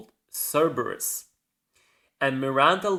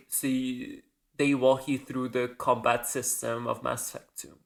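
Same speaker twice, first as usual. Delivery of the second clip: ordinary delivery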